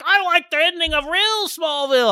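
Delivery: high, slow mocking fan voice; mocking whiny fan. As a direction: mimic a complaining fan, drawn out. Mocking fans' complaint.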